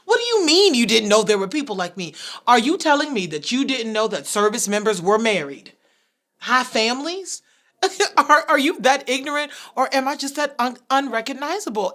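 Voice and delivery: high, loud, furious tirade; indignant yelling, pitched high; outraged screaming. Acted. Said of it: "I was screaming".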